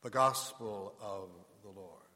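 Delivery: slow, solemn intoned close; liturgical acclamation. Acted. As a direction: intone it slowly like Mass. Closing acclamation of the reading.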